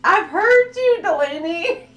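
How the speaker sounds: high, loud teasing words through laughter; laughing call-out. Real, not acted. Streamer laughing at Delaney, loud and high. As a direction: tease her while cracking up.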